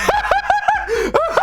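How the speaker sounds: high, wheezy run of laughter; real laughter. Real, not acted. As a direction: crack up at the claim. Cracking up at co-host's claim.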